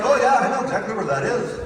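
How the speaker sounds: cheerful Minnesotan bluff; Minnesota accent. Retold or imitated. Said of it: "He's like".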